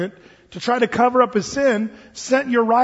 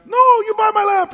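loud, high demand; hammed-up pushy merchant; insistent shopkeeper; 12 semitones higher, 6 dB louder than usual. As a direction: cut them off, high and loud. Acts shopkeeper refusing to let go.

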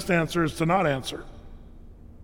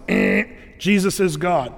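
usual speaking voice

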